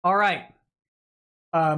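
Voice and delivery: ordinary delivery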